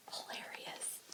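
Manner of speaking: dry whispered aside. Real, not acted. Reader to the listeners, a dry whispered joke. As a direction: breathe it as a wry aside. Wry comment on the fishing target.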